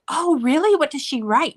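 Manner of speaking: lifted, questioning "oh really" voice; interested teacher. Retold or imitated. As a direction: imitate a teacher's keen curiosity. Teacher's curious reply, acted out.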